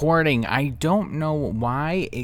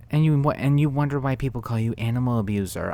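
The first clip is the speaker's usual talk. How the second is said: ordinary delivery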